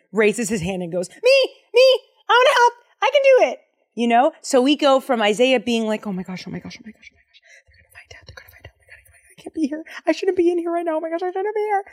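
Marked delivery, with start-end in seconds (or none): 1.1-3.6 put-on much higher, louder eager child voice; giddy kindergartener. Isaiah as an eager little kid volunteering, high and loud. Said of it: "like a giddy little kindergarten student". 6.1-9.4 frantic whispered panic; panicked whisper. Retold or imitated. Isaiah terrified in the temple, whispering in panic. 9.4-11.8 panicky. Retold or imitated. Isaiah panicking in the temple.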